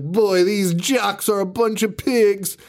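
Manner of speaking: high, slow sneer at the jocks; lightly voiced disgust, drawn out high; a slow, high scoff at the messy jocks; mock disgusted jock-hater. Acting out a character's line.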